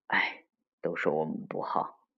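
low, soft, regretful sigh into words; hushed self-reproach. Acted. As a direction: sigh and take the blame. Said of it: "叹了口气".

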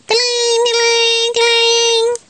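high sung bell dings. Acted. Mother reading the bell ringing, sung high. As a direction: ring it out like a bike bell.

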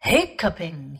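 low amused doubting repeat. Acted. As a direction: question it with a chuckle. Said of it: "Father Bear laughed".

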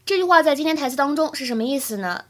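ordinary talk

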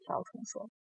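ordinary delivery

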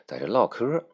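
ordinary delivery

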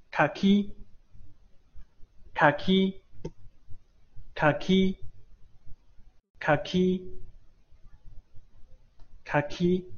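かき repeated slowly for copying; 慢速示范かき; model kaki. Shown as a demonstration. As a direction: drill かき for the class. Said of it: "读作khaki".

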